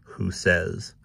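ordinary delivery, nothing marked

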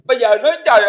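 usual speaking voice

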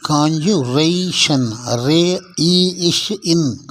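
slow, stretched sounds in காஞ்சுரேஷன்; syllable by syllable. Shown as a demonstration. Teacher breaks conjuration down, slow syllable by syllable. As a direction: draw out ரே இ இஷ் இன் piece by piece.

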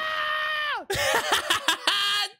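high, shouting burst of laughter; gleeful cackle. Real, not acted. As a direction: cackle with glee at the prank. Gleeful after scaring Floki.

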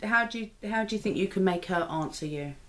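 ordinary delivery

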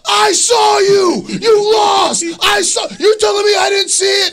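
high, full-volume furious yell; screaming. Retold or imitated. Wrestler acts out angry restaurant fan, screaming accusation. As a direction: scream at the top of your lungs. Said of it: "screaming at the top of his".

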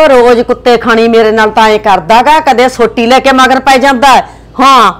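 loud, aggrieved outburst; loud complaint. Acted. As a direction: shout your grievance to the nephew. Wife complains about husband to nephew.

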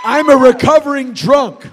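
punched out loud; loud confession. Real, not acted. Preacher confessing aloud to the congregation, loud. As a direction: shout the confession out. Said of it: "grounds for my apology".

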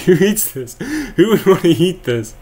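high, incredulous mock disgust; disgusted disbelief. Mocking the people who eat lean steak, in high disgusted disbelief. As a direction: squeal it like you can't believe anyone eats it. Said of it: "I'm like".

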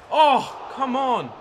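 loud, high cry of disbelief; frustrated shout. Real, not acted. Gamer yelling at his player for missing a great chance, loud and high. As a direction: yell at the screen, gutted.

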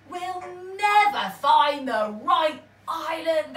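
despairing pirates. A narrator's reading. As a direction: pirates giving up hope. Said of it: "they said".

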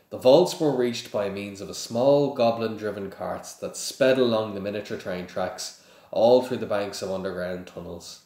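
ordinary delivery, nothing marked

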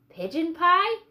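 rising, hopeful question; eager surprise. Acted. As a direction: perk up at the thought of pie. Nathaniel's longing thought.

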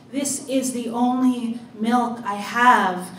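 calling out. A narrator's reading. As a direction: call it out through a snowstorm. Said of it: "I am calling to Miss Birdie".

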